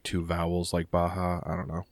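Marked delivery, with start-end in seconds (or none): 0.9-1.4 Baja with an H sound for the J. Shown as a demonstration. Example between two vowels, Baja said with an H. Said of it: "it did the H sound".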